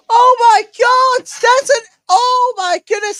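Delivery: high, loud ecstatic shout; goal scream. Real, not acted. Late goal, commentator screams.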